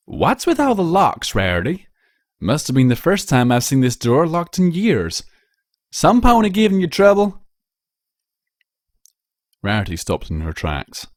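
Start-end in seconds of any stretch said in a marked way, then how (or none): 0.1-7.4 lightly voiced country mare, raised and louder; hint of a folksy farm pony; curious farmer mare. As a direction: ask plainly, a little concerned. Applejack asks about locks.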